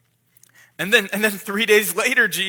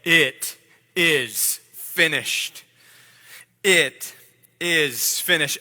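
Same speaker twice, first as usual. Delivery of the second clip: slow, drawn-out proclamation, louder than usual; loud declaration; 4 semitones lower, 3 dB louder, much slower than usual. Acted. Jesus on the cross declaring the work done, slow loud proclamation. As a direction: proclaim it slowly, word by word, with full weight. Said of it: "With a loud voice, say".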